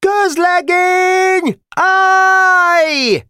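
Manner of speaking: very high, loud military order; harsh commanding shout. A narrator's reading. Lieutenant ordering the private to halt, high crackling shout. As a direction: bellow a drawn-out halt command. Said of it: "recsegő hangon kiáltott utána".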